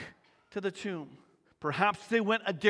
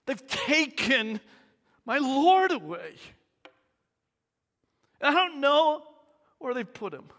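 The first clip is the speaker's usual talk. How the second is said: distressed plea; 6 semitones higher, 6 dB louder than usual. Acted. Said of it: "she says".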